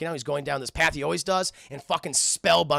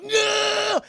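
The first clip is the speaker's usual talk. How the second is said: very high drawn-out "no" scream; acted yell; 5 dB louder than usual. Terry as Cunningham starts shooting, high drawn-out yell. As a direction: cry out in hammed-up alarm. Acting Terry under gunfire.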